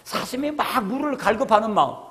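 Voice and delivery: ordinary talk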